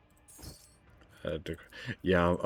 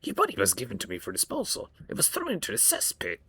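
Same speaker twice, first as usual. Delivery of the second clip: creepy homunculus voice. Acted. Homunculus voice continues.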